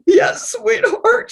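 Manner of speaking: high, slow, tender reply; sweet mom voice. Retold or imitated. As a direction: coo it to your child. Mom answering her little girl.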